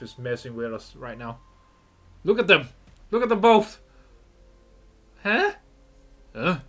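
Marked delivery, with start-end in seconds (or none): 2.2-6.6 loud, high amazed shouts; real excitement. Real, not acted. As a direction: yell in disbelief at the screen. Streamer reacts to the halo.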